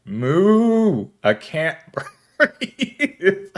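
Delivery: high, slow, laughing cow voice; goofy cow moo, then laughing; silly cow voice. Pretend. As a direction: play the cow, cracking up. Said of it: "It's the cow going".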